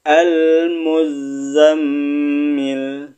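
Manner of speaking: very slow, drawn-out tajwid chant; Al-Muzammil chanted very slowly; Quran recitation. Shown as a demonstration. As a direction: stretch every vowel like a reciter. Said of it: "Kita pindah ke sebelahnya".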